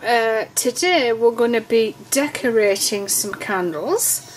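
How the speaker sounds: ordinary delivery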